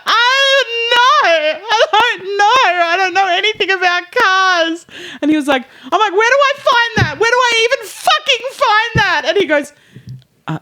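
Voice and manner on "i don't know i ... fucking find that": high, wailing, half-crying panic; mock hysterical crying. To the tire man on the phone, hysterical and wailing. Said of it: "scream crying".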